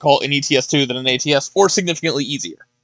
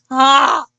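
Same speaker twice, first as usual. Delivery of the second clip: stubbed toe; 9 semitones higher, 3 dB louder than usual. Real, not acted. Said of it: "Sorry, I just stubbed my toe".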